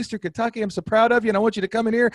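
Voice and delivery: high, friendly coach voice inviting him in; high and hearty, like a proud mentor; Bill Stewart voice. Retold or imitated. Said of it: "and he goes".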